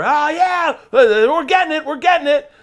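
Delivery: high, loud whooping; excited crew cheering. Retold or imitated. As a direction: holler like a thrilled film crew. Film crew cheering, oblivious.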